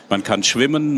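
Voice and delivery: ordinary delivery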